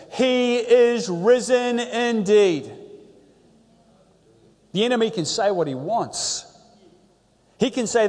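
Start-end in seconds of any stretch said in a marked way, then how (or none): 0.1-2.7 slow, loud, ringing answer from a whole crowd; thundering reply. Retold or imitated. Whole assembly answers the priest, slow thundering reply. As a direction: boom it back slowly like an assembly. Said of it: "the thundering reply came back loud and clear".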